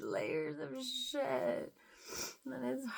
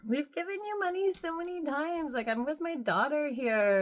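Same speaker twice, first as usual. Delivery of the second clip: annoyed retort; 4 semitones higher, 6 dB louder, faster than usual. Retold or imitated. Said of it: "and I just reacted".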